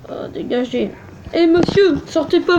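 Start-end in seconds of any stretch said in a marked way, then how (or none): 1.3-2.6 raised, higher call to stop someone; bouncer calling out. Pretend. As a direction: call after a drunk customer.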